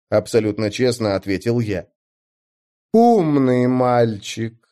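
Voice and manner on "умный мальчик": slow, condescending goddess voice; patronizing tone. Acted. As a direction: drawl it down to a child. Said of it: "покровительствующим тоном проговорила Моргана".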